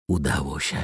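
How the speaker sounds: soft, hushed narrated whisper. A narrator's reading. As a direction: whisper it softly to yourself. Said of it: "wyszeptała". (cichy szept)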